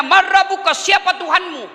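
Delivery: high, emphatic angelic questioning; interrogating angel. Acted. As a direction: demand an answer like the questioning angel. Angel questions the dead again.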